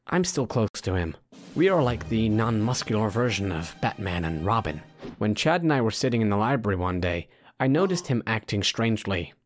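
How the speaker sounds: ordinary delivery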